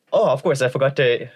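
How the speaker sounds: usual speaking voice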